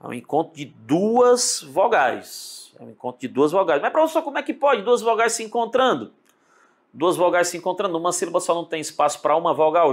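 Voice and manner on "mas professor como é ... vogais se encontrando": higher-pitched student questioning the teacher; incredulous, high-pitched classroom objection; puzzled student. Acted. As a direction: voice a baffled pupil piping up.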